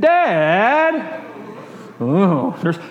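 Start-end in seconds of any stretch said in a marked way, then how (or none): 0.0-1.2 put-on high child's whine on Dad; the word dads hate to hear; whiny kid. Shown as a demonstration. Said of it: "if you're a kid, you know how to say that".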